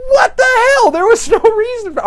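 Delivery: very high, loud protest; hammed-up indignant yelling; mock outrage. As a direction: explode in disbelief, voice way up. Hero outraged at the backhand.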